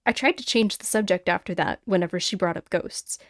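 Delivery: ordinary delivery, nothing marked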